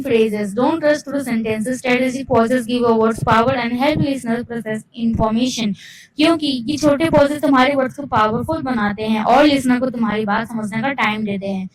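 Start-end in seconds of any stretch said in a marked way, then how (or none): none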